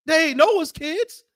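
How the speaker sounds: high, rising sermon rebuke; preacher scolding the congregation with rising fire; fiery preaching. Acted. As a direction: hammer the sinners from the pulpit. Preaching at full force to congregation.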